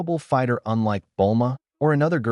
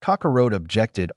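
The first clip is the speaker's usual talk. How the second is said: plain reading voice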